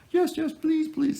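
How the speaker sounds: high, loud falsetto woman imitation; mock tearful begging. Buyer begs on the phone before hanging up, mock pleading falsetto. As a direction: plead eagerly in a put-on squeaky voice. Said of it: "it's like crying".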